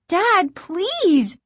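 high, frightened plea; pleading cry. A narrator's reading. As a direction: beg dad in a high child's cry. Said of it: "Margaret cried".

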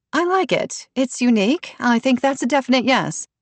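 a touch of eager agreement; approving committee member. A narrator's reading. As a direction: pipe up in favour, pitched high. Asha's enthusiastic approval in dialogue.

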